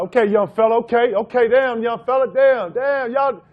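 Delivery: hammed-up Jordan scoring over him; imitating Jordan taunting. Impersonating Jordan taunting the rookie, loud and high. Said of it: "Mike came down".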